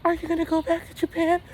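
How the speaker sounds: very high squeaky imitation of her pestering; high mocking girlfriend voice. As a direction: pitch way up as the nagging girlfriend. Mimicking girlfriend.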